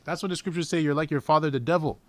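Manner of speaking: ordinary talk, nothing marked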